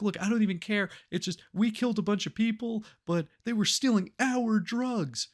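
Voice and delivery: high, insistent blurting; agitated mock confession. Crook confessing to an empty interrogation room, agitated. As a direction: act out a crook spilling it all.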